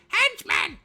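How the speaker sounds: very high, loud screech; piercing bird-like shriek; screeching jays. A narrator's reading. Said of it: "screeched the jays".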